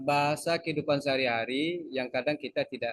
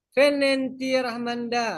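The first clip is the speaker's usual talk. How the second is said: higher, slow Egyptian Arabic question; 9 semitones higher, 4 dB louder than usual. Retold or imitated. Teacher imagines an Egyptian asking Rahmanda where. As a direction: ask it like an Egyptian local. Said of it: "pergi ke Mesir".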